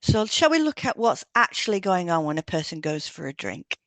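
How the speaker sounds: normal talking voice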